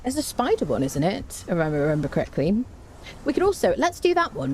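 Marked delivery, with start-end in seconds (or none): none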